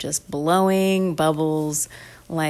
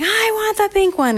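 put-on high-pitched kid's whine; demanding child. Bar tussles over the pink straw, demanding in high voice. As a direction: insist in a high little voice.

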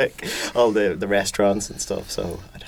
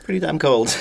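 normal talking voice

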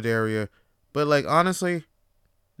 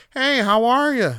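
higher, louder cheery hello; mock a stranger's sunny greeting; imitating a cheery coworker; chirpy greeting; 9 semitones higher, 6 dB louder than usual. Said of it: "Someone's like".

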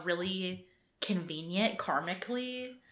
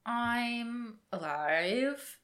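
drawn-out deadpan; 4 semitones higher, much slower than usual. Real, not acted. Deadpan answer about her week.

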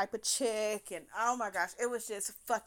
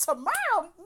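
louder, incredulous echo of the word; disbelieving repeat; 9 semitones higher, 9 dB louder than usual. Retold or imitated. Echoing the date's offer to herself, incredulous. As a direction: say it like you can't believe it.

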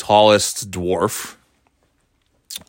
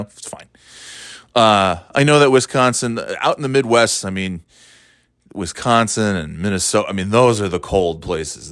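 ordinary talk, nothing marked

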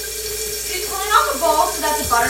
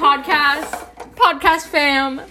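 hyped host sign-off; 3 semitones higher, 6 dB louder, much slower than usual. Acted. Announcing podcast outro.

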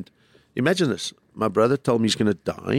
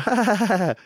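mocking laughter; 12 semitones higher, faster than usual. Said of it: "And then they go".

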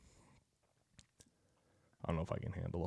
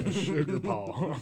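laughing; 8 semitones higher, 7 dB louder, much slower than usual. Acted. Joking daddy voice, laughing.

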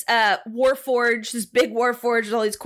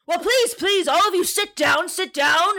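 higher, jovial host urging; hearty invitation; 7 semitones higher than usual. Acted. Commander invites the party to sit, jovial. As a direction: wave everyone to sit.

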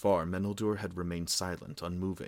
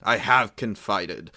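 loud, slow, furious outburst; angry cry; 12 dB louder, slower than usual. A narrator's reading. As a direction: cry it out in rising temper. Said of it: "cried Aldarion, his temper rising".

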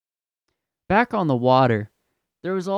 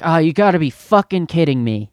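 louder, higher burst of mock exasperation; exasperated customer; 4 semitones higher, 7 dB louder than usual. Suited customer opening the dairy door, mock exasperated. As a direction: imitate an irritated shopper hitting the swear word.

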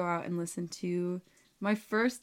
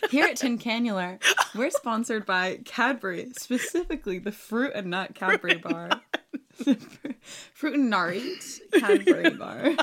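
higher, louder hammed-up sponsor plug; mock ad read; 4 semitones higher, 6 dB louder than usual. Joking that Cadbury sponsors the podcast, a hammed-up ad. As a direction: ham up a sponsor read, laughing.

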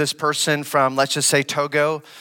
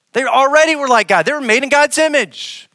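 much higher and louder, fast insistence; emphatic rebuttal; 10 semitones higher, 6 dB louder than usual. Real, not acted. Preacher insisting to the congregation, higher and louder. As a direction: drive it home that they were already like God.

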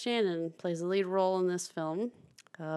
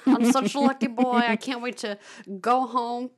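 higher, louder imitation of a gleeful clip; Michael Shannon; 5 semitones higher, 8 dB louder than usual. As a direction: mimic an actor's silly delight, pitched up. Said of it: "the clip where he's like".

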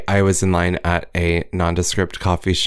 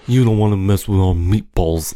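mock mobster Jersey accent; New Jersey accent. Imitating the housewives' mafia act, New Jersey accent. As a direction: threaten in a put-on Jersey accent. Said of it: "Jersey accent is just different".

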